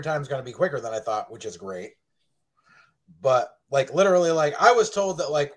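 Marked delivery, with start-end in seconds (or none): none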